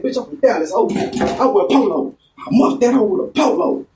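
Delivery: friend's voice pitched high throughout; quoting his friend. Retold or imitated.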